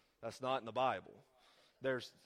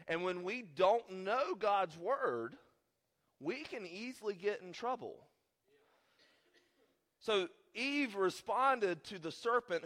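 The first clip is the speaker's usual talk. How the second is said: raised, slow preaching delivery; 7 semitones higher, 5 dB louder, slower than usual. Real, not acted. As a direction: stretch out the key words to drive the point home.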